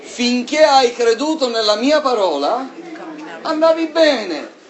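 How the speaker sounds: high, loud preaching climax; fervent sermon peak; impassioned preaching. Acted. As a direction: drive the point home to the congregation.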